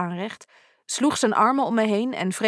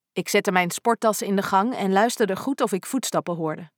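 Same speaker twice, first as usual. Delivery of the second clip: ordinary delivery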